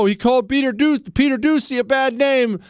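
high mocking whine. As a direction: mimic the fuss, scornful. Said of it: "this whole call".

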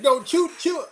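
high-pitched panicky urging; mock panic. Fan off the couch urging Bongi to shoot, mock panic. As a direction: act out an anxious fan urging the striker on, voice jumping up. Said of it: "it was almost a panicky moment".

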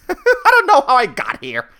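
amused, helpless laugh while talking. Real, not acted. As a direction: crack up at your own rant. Laughs at own sudden rant.

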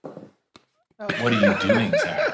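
slow, breaking into laughter; laughing HAL impression. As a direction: HAL line dissolving in giggles. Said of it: "like Hal in, in two thousand one".

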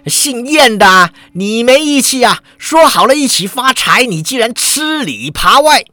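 high-pitched shouted accusation; angry shout. A narrator's reading. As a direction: lash out at a traitor. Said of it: "有人喝道".

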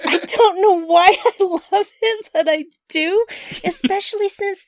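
high and loud, gushing with glee; excited. Real, not acted. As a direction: burst out with delight. Gushing about loving Fallout.

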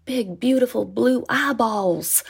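a hint of an endearing Southern drawl, slow; lightly voiced jolly Southern man, slowed down; Southern accent. Stranger admiring the baby, a hint of a Southern accent. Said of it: "his accent was very endearing".

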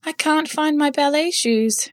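high, slow tearful voice; sad ballerina. A narrator's reading.

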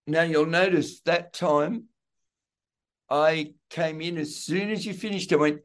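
usual speaking voice

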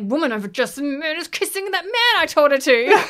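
high, loud rant building into laughter; mock jealous rage. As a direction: splutter like a hammed-up jealous lover. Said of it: "He's so jealous".

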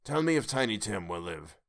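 put-on old miser quietly begging for news; Scrooge anxiously asking. A narrator's reading. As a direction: ask as if dreading the answer. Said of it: "with an interest he had never felt before".

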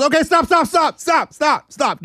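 high, frantic begging to stop; imitating a panicked woman begging; panicked pleading. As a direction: beg them to quit, rising in panic. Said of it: "and she goes".

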